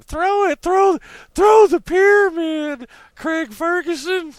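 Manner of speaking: high, loud and slow, like yelling across a crowd; calling out. Pretend. Fans urging Craig Ferguson to throw the pyramid, loud high call. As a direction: yell it at him to throw the sign.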